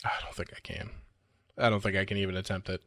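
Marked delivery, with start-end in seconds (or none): 0.0-0.9 whispering. Real, not acted. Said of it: "whispered refusal".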